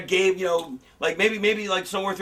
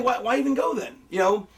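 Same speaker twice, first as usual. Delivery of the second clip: normal talking voice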